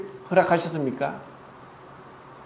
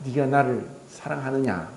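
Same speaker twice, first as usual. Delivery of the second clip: Jesus gently asking Peter, lower and softer, no reproach; tender question; 6 semitones lower, 7 dB quieter than usual. Acted. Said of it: "질책한 것이 아니라".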